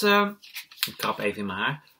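usual speaking voice

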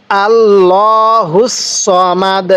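আল্লাহুস সমাদ drawn out slowly; slow recitation. Shown as a demonstration. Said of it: "এটা কিন্তু সদ উচ্চারণ করব".

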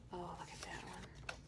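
soft breathy whisper of delight; awed whisper. Real, not acted. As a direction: hush it like a secret treasure. Awed at opened mail.

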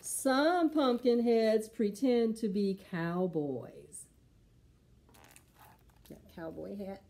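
slow, expressive picture book reading; storybook voice for preschoolers; reading to children. Acted. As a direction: read it slow and lively for little ones.

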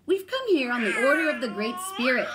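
high, loud proclamation; ringing storybook announcement; grand declaration. A narrator's reading. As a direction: declare it like an official herald. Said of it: "they declared".

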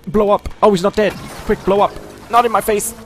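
urgent panicked shouting; frantic yelling. Real, not acted. Gamer in a frantic fight, shouting.

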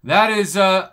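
ordinary delivery

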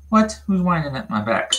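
ordinary talk

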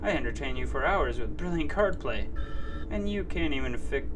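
loud, defensive robot ranting; angry robot voice. Acted. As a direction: bluster loudly like a guilty robot.

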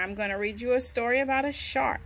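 usual speaking voice

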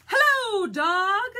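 high, loud storybook duck hailing a friend; calling out a greeting. Acted. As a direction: sing out a big hello as the duck. Duck calls to Dog in story.

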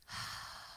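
slow audible exhale; soft sighing out-breath, the name of God; long breath out. Shown as a demonstration. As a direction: breathe out slowly so it can be heard. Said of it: "That's his name".

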